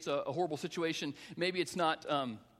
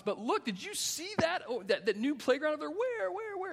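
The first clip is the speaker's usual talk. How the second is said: mock-excited parent diverting attention; distracting excitement; 12 semitones higher than usual. Parent diverting kids on the way to shots, excited. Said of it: "kind of the distraction thing".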